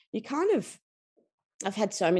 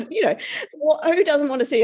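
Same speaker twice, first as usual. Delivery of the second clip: laughing; 12 semitones higher, 6 dB louder than usual. Real, not acted. Laughing at keg stand image.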